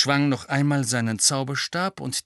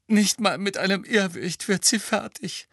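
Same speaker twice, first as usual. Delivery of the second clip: tearful self-mockery; 10 semitones higher, 4 dB louder than usual. A narrator's reading. Molly mocking herself tearfully.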